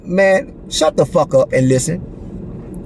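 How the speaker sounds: low, slow commanding rebuke; deep slow voice shutting the skeptic down; voice of God. Retold or imitated. God's voice telling him off.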